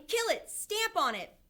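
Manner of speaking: high, loud urgent commands; shouting. A narrator's reading. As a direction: egg the others on with a shout. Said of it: "they were shouting".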